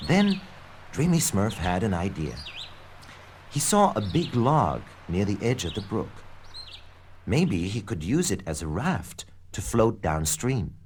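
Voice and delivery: usual speaking voice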